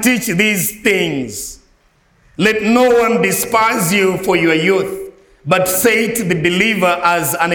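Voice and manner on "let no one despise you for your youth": usual speaking voice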